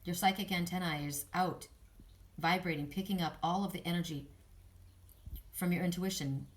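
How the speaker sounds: calm meditative affirmations; guided meditation. Acted. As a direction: gently guide the listener inward.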